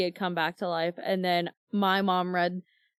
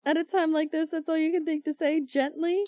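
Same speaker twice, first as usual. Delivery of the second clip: higher, indignant quoting; hurt and incredulous, a bit higher; lightly voiced Buttercup; 7 semitones higher than usual. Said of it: "And he says".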